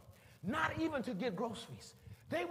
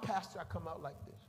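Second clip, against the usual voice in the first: ordinary delivery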